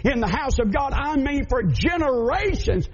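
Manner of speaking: preacher shouting the blessing; climactic preaching. Acted. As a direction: drive it home to the congregation. Sermon climax on Obed-Edom.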